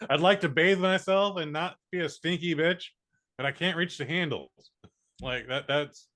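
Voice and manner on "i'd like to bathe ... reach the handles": higher-pitched imitation of an exasperated girl; lightly hammed-up protest; mock complaint. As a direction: plead a silly case in her voice. Voicing the girl's retold complaint.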